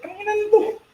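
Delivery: high-pitched mock curse; imitated outburst. Band member mimics an out-of-context heckle, high-pitched outburst. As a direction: blurt it way up high, out of nowhere.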